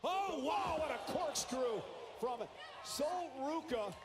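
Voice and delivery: loud, high, drawn-out play-by-play call; excited commentary. Real, not acted. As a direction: belt out the big move. Big move call on live commentary.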